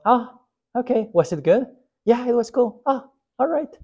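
very high, casual sing-song chat; lightly mocking, chirpy nonchalance; breezy acceptance. Pastor plays both friends shrugging off missed church, high breezy chatter.

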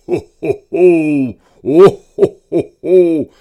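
very loud jolly laugh; big jolly ho ho ho; booming ho ho ho. Acted. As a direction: boom it out like Father Christmas. Father Christmas arrives.

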